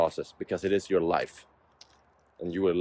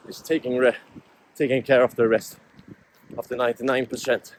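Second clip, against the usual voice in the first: raised and louder, pushing words out between strides; talking mid-run; 7 semitones higher, 7 dB louder than usual. Real, not acted. Vlogger sharing thoughts to camera while running, breathless and loud. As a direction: speak while jogging, breath working hard.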